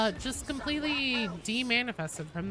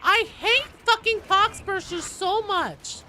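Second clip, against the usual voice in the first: very high, very loud screaming rage; furious shriek; 10 semitones higher, 9 dB louder than usual. Real, not acted. Gamer raging at pox bursters, shrieking. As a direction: scream it out in pure fury.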